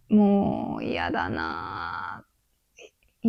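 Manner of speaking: low, slow, drawn-out grumble; fed-up groan. Retold or imitated. Wine spilled on her trousers, a low weary groan. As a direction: sink into a weary low moan.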